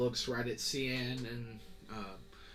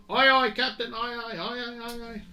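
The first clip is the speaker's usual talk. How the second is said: much higher, loud repeated aye, fading; eager sailor; 12 semitones higher, 12 dB louder than usual. Acted. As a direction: answer the captain eagerly. Sailor answers order.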